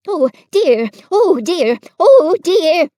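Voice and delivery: small frightened pig building to a squeal; fretful Piglet. A narrator's reading. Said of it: "said Piglet to himself".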